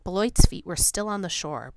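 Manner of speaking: plain reading voice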